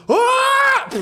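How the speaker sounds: high shouted kiai; mock karate shout. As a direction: yell it like smashing a board. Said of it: "he goes".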